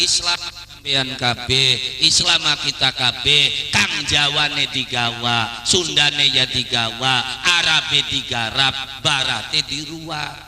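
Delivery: slow, loud rhythmic recitation; emphatic drawn-out proverb delivery; Sunan Kalijaga's testament. Acted. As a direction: declaim it line by line, stretching the endings. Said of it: "Makanya Sunan Kalijaga wasiat".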